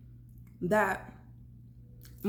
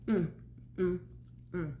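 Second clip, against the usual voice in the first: appreciative mm while chewing; 2 semitones lower, 7 dB quieter than usual. Real, not acted. Tasting food in mukbang.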